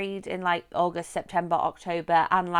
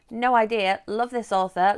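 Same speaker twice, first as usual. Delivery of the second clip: ordinary delivery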